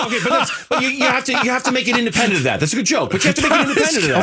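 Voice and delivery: high, quick laughing pushback; giggly insistence, voice climbing; laughing protest. Real, not acted. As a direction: laugh while insisting, pitch jumping up.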